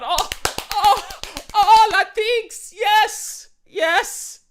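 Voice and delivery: high, slow, laughing gush of delight; ecstatic mock Peter. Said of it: "No, like, Peter".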